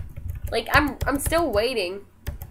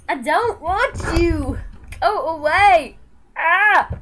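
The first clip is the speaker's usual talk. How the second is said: louder push-away; 6 semitones higher, 10 dB louder, slower than usual. Real, not acted. Pushing dog away, louder.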